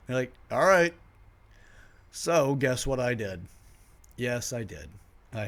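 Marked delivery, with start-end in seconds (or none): 0.5-0.9 high, loud, caught out; surprised. Retold or imitated. Friends giving in, high and loud. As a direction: blurt it in surprise.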